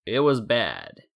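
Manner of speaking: usual speaking voice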